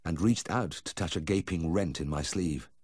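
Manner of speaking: usual speaking voice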